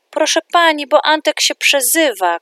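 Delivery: high, loud tattling cry to the teacher; boy shouting a complaint to the teacher; child shouting. A narrator's reading. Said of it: "krzyknął nagle Julek".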